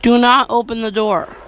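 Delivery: ordinary delivery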